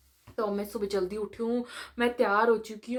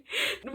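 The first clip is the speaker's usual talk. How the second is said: short laugh; 4 dB quieter than usual. Real, not acted. Laughs mid-story about crying.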